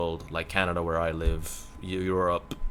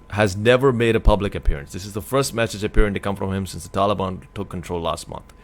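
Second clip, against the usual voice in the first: louder, formal news-read voice; reading the headline aloud; news article read; 6 dB louder than usual. Acted. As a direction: read it out like a report.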